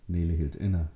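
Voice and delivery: plain reading voice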